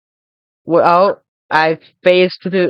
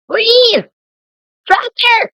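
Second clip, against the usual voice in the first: high shout; 14 semitones higher, 4 dB louder, much slower than usual. Real, not acted. Shouting at game tractor.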